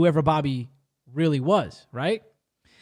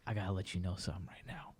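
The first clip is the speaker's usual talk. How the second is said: much lower, much softer grave confession; gloomy confession; 10 semitones lower, 8 dB quieter than usual. Retold or imitated. Simran confessing to her cousin, low and grave. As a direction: break the bad news low and heavy. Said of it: "She has a very gloomy expression".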